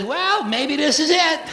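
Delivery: high, slow innocent voice; little and hopeful, in no hurry; high kitten voice, slow; naive kitten. Acted. Little cat voiced stuck on wall.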